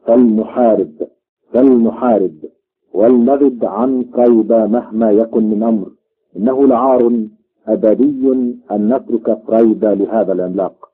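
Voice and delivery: ordinary delivery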